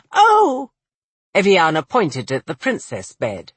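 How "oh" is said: put-on very high, louder little-girl cry; excited child. A narrator's reading. Child spots the princess bed, high and excited. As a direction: exclaim like a delighted small child.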